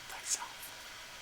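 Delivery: breathy dropped whisper. Real, not acted. Reader telling Cancer to love thyself, in a whisper. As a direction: whisper the last word like a secret.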